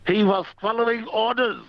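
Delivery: high-pitched comic German voice; mock German accent. Caller tells host a German swan joke, mock German accent. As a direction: deliver the punchline in a put-on German accent.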